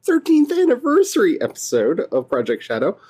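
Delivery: bright, fast show-host welcome; high and lively host greeting; podcast intro. Acted. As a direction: welcome listeners like a host kicking off a special episode.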